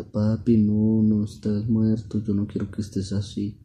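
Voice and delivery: high, loud, drawn-out plea; storyteller's mock-crying little girl; tearful pleading. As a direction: beg papa, lightly acting the tears. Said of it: "Y lloraba y lloraba y decía".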